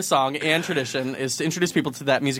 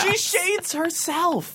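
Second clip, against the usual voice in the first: diva voice; 11 semitones higher, much slower than usual. Pretend. Mock diva commentary.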